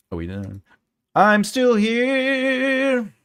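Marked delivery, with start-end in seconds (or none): 1.1-3.1 slow, high put-on voice; drawn-out mock voice; lightly voiced silly drawl.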